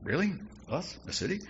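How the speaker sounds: high, incredulous questions; mock disbelief. Disciples doubting they are the city, mildly incredulous. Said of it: "They're going".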